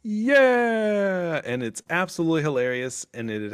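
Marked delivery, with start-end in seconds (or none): 0.0-1.4 long, high, drawn-out cheer; imitated crowd cheer. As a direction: answer like a whole crowd, stretched out. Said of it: "the rest of the crowd's all".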